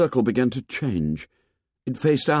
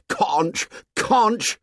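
high, scornful shouted mockery; shouting; 13 semitones higher than usual. A narrator's reading. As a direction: hurl the word back in scorn. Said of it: "shouted Jack".

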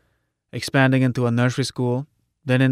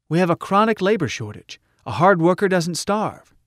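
higher-voiced, emphatic appeal; encouraging rally orator; 5 semitones higher than usual. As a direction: call on listeners to come and start over. Orator continuing her speech.

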